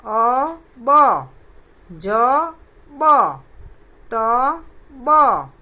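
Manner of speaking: each pair punched out loud; loud syllable drill. Shown as a demonstration. Teacher showing children how to pronounce, loud and clear. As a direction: call out the letter pairs like a drill. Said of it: "मैं कैसे कर रही हूँ".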